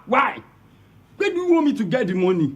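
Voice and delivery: angry. Acted. Father angry about the money.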